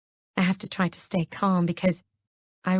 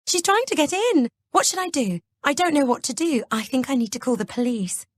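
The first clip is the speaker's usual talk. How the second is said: fake panic; 6 semitones higher than usual. A narrator's reading. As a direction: sound desperate and terrified. Kelly fakes panic.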